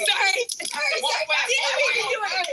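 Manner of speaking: giddy with laughter at the joke; laughing apology. Real, not acted. Joking bit in the studio.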